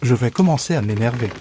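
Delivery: ordinary talk, nothing marked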